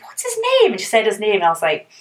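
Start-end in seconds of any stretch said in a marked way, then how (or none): 0.0-0.7 high, alarmed question; suspicious question. Retold or imitated. Asking friend about her date, high suspicious question. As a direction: ask it with sudden high suspicion.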